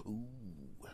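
whispered, low delighted ooh; savoring bear. Acted. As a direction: whisper like tasting blood for the first time. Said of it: "That bear nicks you".

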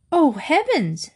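an understated storybook shriek from a startled mother; mild mock shriek. A narrator's reading. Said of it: "shrieked Mother".

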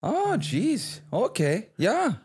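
hammed-up Fargo accent, very high; exaggerated high-pitched Midwest accent. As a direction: agree in high Minnesotan swoops. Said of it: "talk like we're from the Midwest there".

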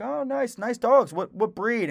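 high-pitched friendly stranger act; admiring older stranger. Retold or imitated. Older man chatting to a dog owner in the park, admiring, pitched high.

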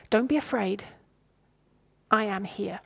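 soft, calm and kind; quiet comforting words to frightened friends; gentle reassurance. Acted. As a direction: say it softly, like a trusted teacher. Jesus speaking to friends gently.

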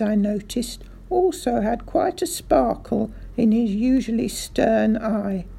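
usual speaking voice

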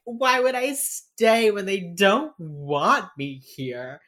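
loud, high, drawn-out mock outburst; hurt, angry protest. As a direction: imitate a melodramatic wronged son. Retold brother storming out, protesting.

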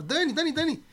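high, insistent name calling; retold calling out. Friend tries to stop Dani going home, retold calling out. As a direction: imitate grabbing someone's attention.

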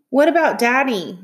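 pleading cry. A narrator's reading. Child protesting to mom at the door, mildly crying out. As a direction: call it out as a child's plea. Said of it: "I cried".

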